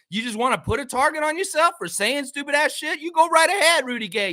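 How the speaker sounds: mocking rant; taunting yell. Real, not acted. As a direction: dare him to go ahead. Angry rant at player.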